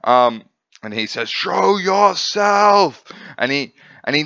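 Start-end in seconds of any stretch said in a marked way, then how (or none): 1.3-3.0 slow, drawn-out high yell of a dino warrior; stretched, commanding battle cry; villain shout. Retold or imitated. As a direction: bellow it out, challenging the invisible foe. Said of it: "that's why he's screaming".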